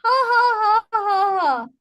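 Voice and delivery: deliberate high ha-ha, descending; rhythmic staged laughter; laughter yoga laugh. Shown as a demonstration. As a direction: punctuate the introduction with a forced laugh. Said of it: "to laugh".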